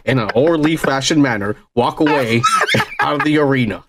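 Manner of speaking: low, formal PA announcer impression; deep, stiff apology over the loudspeaker. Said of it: "the announcer's being like".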